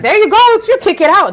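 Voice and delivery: high, loud cheering on; encouraging boss. Retold or imitated. Boss urging her to quit for tarot, high loud cheer.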